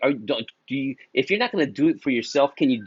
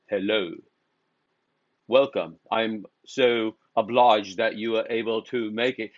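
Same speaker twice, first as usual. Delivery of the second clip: formal British welcome in a lower voice; put-on British; 3 semitones lower than usual. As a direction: greet them like a very proper British host. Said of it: "fucking became British overnight".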